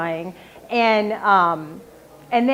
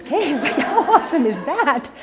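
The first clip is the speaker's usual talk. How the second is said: laughing; 6 semitones higher than usual. Retold or imitated. Gleeful relief after winning the case.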